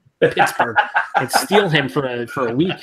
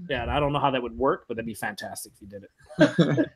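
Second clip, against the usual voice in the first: usual speaking voice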